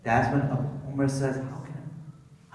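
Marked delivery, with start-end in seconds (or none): 1.4-2.5 a slow, breathless whisper of defeat; whispered disbelief, almost to himself; exasperated whisper. Retold or imitated. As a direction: let it out like you've just given up. Umar despairs of ever competing.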